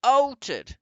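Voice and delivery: high, loud outraged shout. A narrator's reading. Father shouting at the grandmother about his son, outraged. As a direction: explode with outrage. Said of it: "shouted Mister Jenkins".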